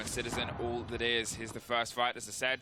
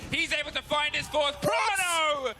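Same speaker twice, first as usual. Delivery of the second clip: excited shout; 10 semitones higher, 4 dB louder, slower than usual. Real, not acted. Caster erupts at fourth kill.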